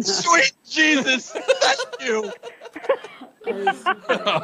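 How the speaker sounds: high, slow, cackling gasp; laughing cry of thanks. Real, not acted. Overwhelmed by laughter.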